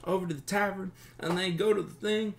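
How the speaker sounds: high, hurried, stammering voice; nervous captive bandit. Acted. Captive bandit recalls what his friend said, high and flustered. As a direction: blurt it out with false starts.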